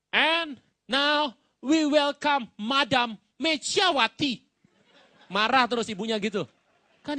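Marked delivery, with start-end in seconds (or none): 0.1-4.4 put-on slow, high, loud Chinese-accented English. Chinese protocol officer welcoming Megawati, in a Chinese accent. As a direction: announce "Mechiawati" in a Chinese accent, slowly. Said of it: "Protokoler China bilang begini".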